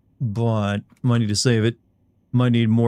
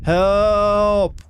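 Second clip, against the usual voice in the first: very high, long held yell; yelled call for help; 10 semitones higher than usual. Pretend. Player yelling into the game for help, high sustained mock yell. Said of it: "I'm yelling into the game".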